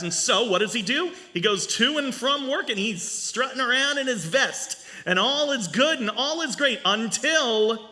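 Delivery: ordinary delivery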